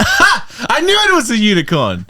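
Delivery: high, drawn-out gotcha; mock triumph. Friend spotting the lie in the game, mock-triumphant. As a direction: crow it high and slow. Acted skit, guessed the lie.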